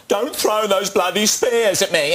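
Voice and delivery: high mock Michael Caine line from Zulu; hammed-up Michael Caine in Zulu. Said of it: "he's doing Michael Caine in Zulu".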